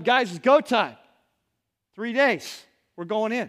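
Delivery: loud, eager announcement of go time; rally the tribe, it's time. Acted. Tribe leader tells his people, loud and eager. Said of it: "the leader of your tribe comes up".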